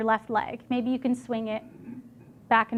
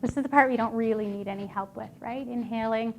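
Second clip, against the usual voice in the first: guided breathing; 2 semitones lower, 5 dB quieter than usual. Acted. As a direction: calmly guide the breath, softly.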